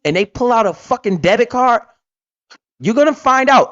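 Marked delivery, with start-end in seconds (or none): none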